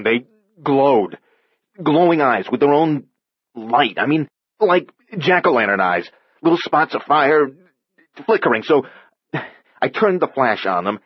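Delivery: frightened Andy. Acted. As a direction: tell it in awe and fear. Andy describes the creatures.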